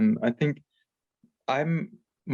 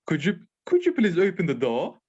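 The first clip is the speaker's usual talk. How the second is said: higher-pitched, polite mock-British request; British accent; 6 semitones higher than usual. Shown as a demonstration. Asking family to open the door, higher mock British accent. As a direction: put on an old British accent, lifting the pitch. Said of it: "that's my old British accent".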